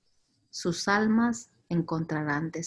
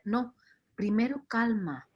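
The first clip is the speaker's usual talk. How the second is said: ordinary talk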